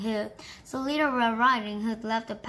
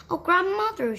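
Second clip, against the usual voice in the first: amazed; 8 semitones higher, 4 dB louder than usual. Acted. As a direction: marvel at grandmother's ears. Wondering at hairy hands.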